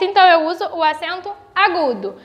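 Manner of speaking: ordinary delivery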